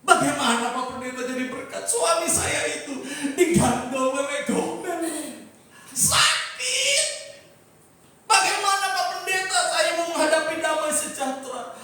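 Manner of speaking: hammed-up wounded congregant objecting; mock exasperated complaint. Preacher mimics wife complaining to pastor about husband, comic exasperation.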